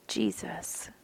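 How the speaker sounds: breathy aside. Real, not acted. Exasperated aside.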